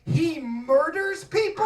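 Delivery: ordinary delivery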